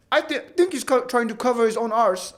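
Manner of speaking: light, badly faked Indian accent. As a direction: read the comment in a mock Indian accent. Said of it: "I did, like, an Indian accent".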